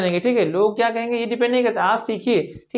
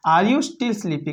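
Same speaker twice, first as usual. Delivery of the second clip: slow model sentence; much slower than usual. Shown as a demonstration. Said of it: "तो क्या बोलेंगे आप?".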